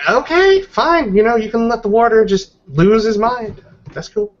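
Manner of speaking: high, pouty mock acceptance; sarcastic. Real, not acted. Player sulks at GM's refusal.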